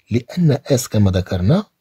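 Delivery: ordinary talk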